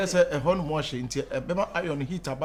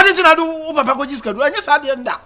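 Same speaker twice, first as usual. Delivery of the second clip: high, loud tirade; heated, full-voiced outburst; angry accusation; 10 semitones higher, 14 dB louder than usual. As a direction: shout the accusation at high pitch. Shouted accusation in the quarrel.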